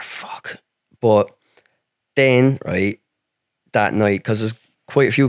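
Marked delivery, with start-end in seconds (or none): 0.0-0.6 hearing news of family travel, whispered curse. Retold or imitated. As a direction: breathe the curse out unvoiced. Hearing family must get visas.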